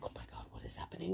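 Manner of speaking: fast, breathless whisper of disbelief; stunned whisper. Retold or imitated. Swarmed by excited fans, whispering in stunned disbelief. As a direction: whisper it as if you can't believe it.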